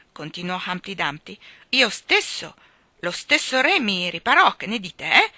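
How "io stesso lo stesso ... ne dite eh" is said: loud, higher-pitched boast ending in a challenge; shrieked last words. A narrator's reading. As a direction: call it out proudly, raising the voice. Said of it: "Egli strillò le ultime parole".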